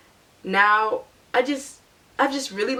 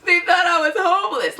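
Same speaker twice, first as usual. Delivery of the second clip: higher, slow, half laughing and half sobbing; laugh-crying; 9 semitones higher than usual. Retold or imitated. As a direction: retell the crying while laughing at it. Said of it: "'cause I'm still crying".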